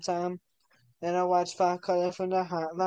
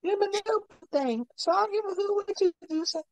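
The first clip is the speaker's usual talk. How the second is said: high silly voice; 12 semitones higher, faster than usual. Pretend. Put-on high voice.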